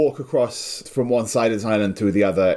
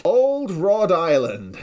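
slow, higher, like a movie title; grand title announcement; 6 semitones higher, much slower than usual. Acted. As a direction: savour the name slowly like a title. Proposing name like a title.

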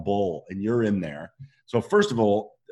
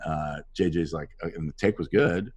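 ordinary talk, nothing marked

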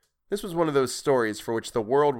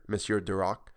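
ordinary delivery, nothing marked

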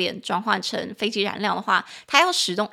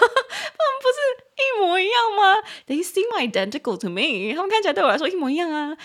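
laughing; 10 semitones higher, 3 dB louder than usual. Retold or imitated.

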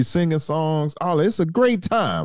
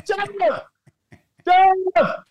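calling out. Retold or imitated. Calling Jeff who drives by.

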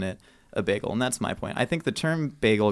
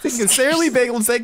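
mock emotional; 13 semitones higher, 5 dB louder, faster than usual. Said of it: "I'm getting emotional".

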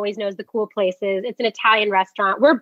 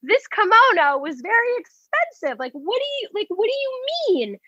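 high-pitched, affronted imitation; mock indignation; 11 semitones higher than usual. Boyfriend defending his kimono, mock indignation. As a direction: protest like an offended boyfriend, pitched way up.

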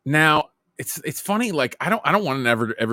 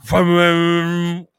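a loud, higher engine vroom; vroom sound effect; 5 semitones higher, 6 dB louder than usual. Shown as a demonstration. Friend demoing a sound to a friend, a vroom noise. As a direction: make the car noise with your mouth. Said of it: "Like, vroom".

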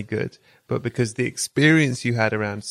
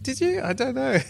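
higher, skeptical mock disbelief; skeptical doubt; 12 semitones higher than usual. Friend doubting the bully is finished, skeptical. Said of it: "And you're like".

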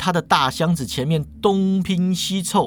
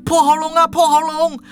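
high, loud mock scream; hammed-up high yell; 10 semitones higher, 5 dB louder than usual. A narrator's reading. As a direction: cry out like a woman calling for help. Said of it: "女的就大叫啦".

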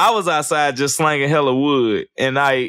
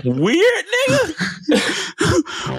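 high, laughing mock Spanish-accent "Weird"; much slower than usual. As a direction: toss it off laughing, in a joke accent.

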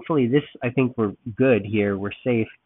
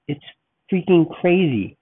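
high, loud amazed burst; excited exclaim; 7 semitones higher, 6 dB louder than usual. Real, not acted. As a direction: blurt it out in awe. Reacting to the storm.

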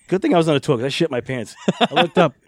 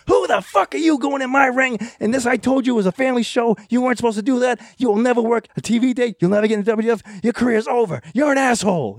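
high, furious retold tirade, pitched way up; imitating screaming rage; 11 semitones higher than usual. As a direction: hammer the kid like an enraged booker. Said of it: "He just screaming at him".

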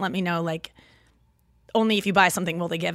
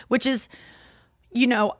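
ordinary delivery

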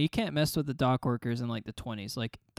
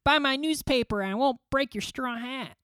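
newsboy voice; 12 semitones higher than usual. Pretend. Acting 1910s newsboy threat.

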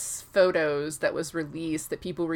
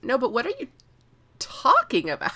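higher-pitched mock bafflement, pausing before "talking"; insistent retold question; 6 semitones higher than usual. Edric keeps asking what Scytale means, insistently baffled. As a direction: press the question harder, acting baffled.

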